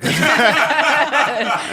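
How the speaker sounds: loud burst of laughter; laughing. Real, not acted.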